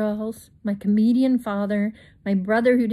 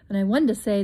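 ordinary delivery, nothing marked

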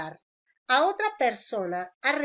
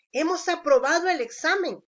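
higher and louder, full of joy; excited exclamation; 6 semitones higher, 5 dB louder than usual. Shown as a demonstration. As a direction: announce passing the exam with delight. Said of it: "Es una oración exclamativa".

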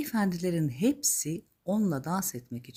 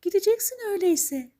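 very high magical lady voice; high, assured fairy voice; fairy godmother; 4 dB louder, faster than usual. Acted. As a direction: promise it in a high storybook voice. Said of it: "demiş ses".

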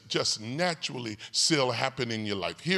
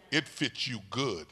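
ordinary delivery

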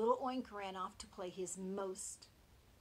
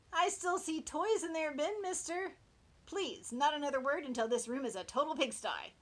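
scolding parent; 8 semitones higher, 9 dB louder than usual. Acted.

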